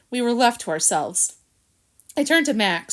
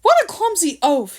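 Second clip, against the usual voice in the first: loud, high angry shout; shouted insult; 9 semitones higher, 6 dB louder than usual. A narrator's reading. Harrison yelling at Jill over dropped debris, angry shout. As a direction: yell it at her in contempt. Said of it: "he shouted".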